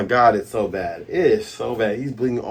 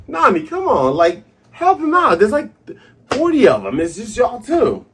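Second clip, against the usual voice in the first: high laughing protest; excited; 10 semitones higher, 4 dB louder than usual. Real, not acted. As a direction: urge her on, laughing. Laughing, urging Nami to help.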